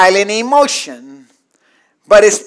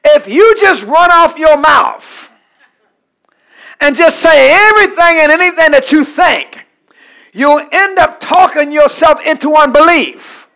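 loud, raised-pitch sermon warning; forceful pulpit warning; full-force preaching; 7 semitones higher, 9 dB louder than usual. Real, not acted. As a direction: preach it hard and high.

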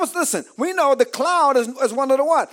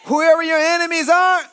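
building preaching; 4 semitones higher than usual. Acted. Preacher declaring victory over enemies, building full-force preaching. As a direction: build the refrain to a preacher's shout.

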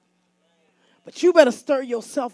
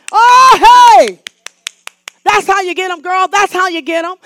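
cheering crowd voice; 5 semitones higher, 11 dB louder than usual. Retold or imitated.

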